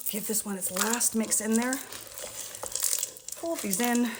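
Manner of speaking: breathless from mixing by hand; puffing a little between words; out of breath. Real, not acted. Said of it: "I'm out of breath again".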